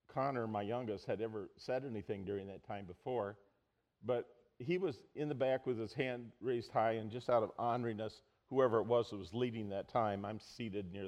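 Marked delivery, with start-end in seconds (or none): none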